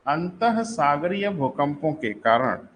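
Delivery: each word spaced out slowly for note-taking; slow dictation. Shown as a demonstration. Teacher dictating the definition slowly to students. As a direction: read it slowly so students can write it down. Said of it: "देखिए, अंतः सागरीय भूकंपों के कारण".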